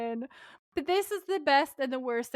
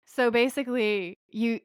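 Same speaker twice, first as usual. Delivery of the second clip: ordinary delivery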